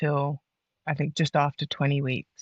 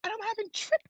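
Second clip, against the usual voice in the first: incredulous panic; 14 semitones higher than usual. Real, not acted. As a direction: let the panic rise into a squeak. Retelling panic about triplets.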